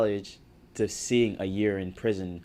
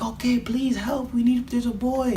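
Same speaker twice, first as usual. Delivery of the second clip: frantic lady; 3 dB louder than usual. Retold or imitated. Jamaican lady flagging down the cop car, high pleading voice.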